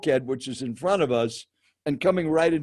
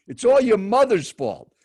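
higher, louder mock blame; imitated accusation; 6 semitones higher, 5 dB louder than usual. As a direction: land it like the seatmate's blunt verdict. Acted stranger's blunt accusation.

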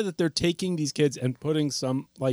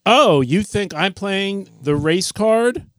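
queeny deadpan sarcasm; 6 semitones higher, 5 dB louder than usual. Acted. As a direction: put on a slow, queeny fake deadpan.

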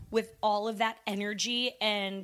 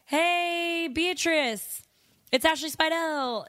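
higher, sung-out hello; mock cheery greeting; 6 semitones higher, slower than usual. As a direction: call out a greeting to an old acquaintance. Acting out her ideal greeting.

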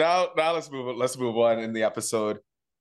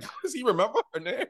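high, quick incredulous question; baffled and pitched way up; mock disbelief; 10 semitones higher, faster than usual. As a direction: rattle it off high, as a baffled inner thought. Voices incredulous inner thought.

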